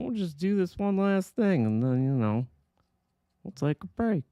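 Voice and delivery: tired voice. Pretend. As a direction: push it out like you're exhausted and punchy. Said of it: "That's my tired voice".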